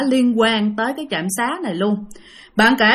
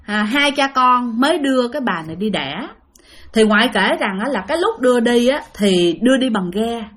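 plain reading voice

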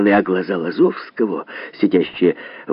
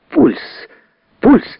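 sharp, high-pitched call of alarm; urgent cry; 4 dB louder than usual. A narrator's reading. Chloroform doctor alerting the surgeons, a high urgent cry. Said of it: "крикнул хлороформатор".

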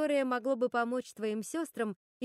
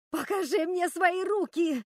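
high, loud, slow furious yell; screaming; 9 semitones higher, 7 dB louder, slower than usual. A narrator's reading. Готель demands to see Руби's hands, screaming in rage. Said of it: "завопила Готель".

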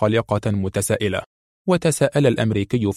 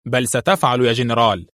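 ordinary delivery

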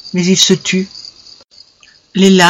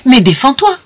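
high, exasperated challenge; agitated burst, pitch jumping up; overwrought demand; 8 semitones higher than usual. A narrator's reading. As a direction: goad them into defending themselves. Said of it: "cette impassibilité surexcitait encore davantage".

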